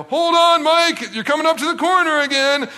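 put-on high, loud sing-out to a small child; hammed-up kiddie-ride excitement; mock excited calling. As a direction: play up the thrill of a tiny ride.

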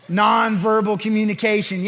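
normal talking voice

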